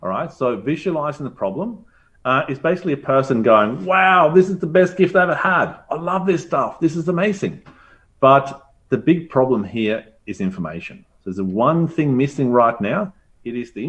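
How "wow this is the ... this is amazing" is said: higher, louder mock gushing; delighted. Teacher acting a gift receiver, higher and gushing. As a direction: gush like someone thrilled with a gift.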